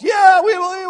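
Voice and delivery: high mock crowd shout; cheering crowd. Crowd answering the tightrope walker, high excited shout.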